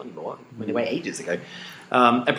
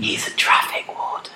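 whispering. Acted. Whispered reply.

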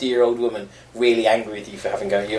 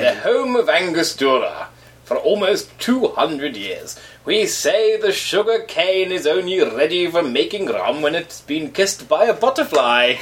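put-on slow, hammy Caribbean accent; put-on Caribbean accent reading a label; 3 semitones higher, slower than usual. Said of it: "in my best Caribbean accent".

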